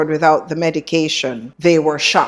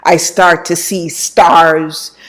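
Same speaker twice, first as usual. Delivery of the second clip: ordinary delivery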